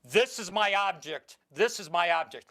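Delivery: ordinary delivery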